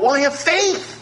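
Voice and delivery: high, airy mock of a politician; lightly mocking politician voice. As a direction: mimic a politician's empty faith talk. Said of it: "you hear a lot of politicians talk about".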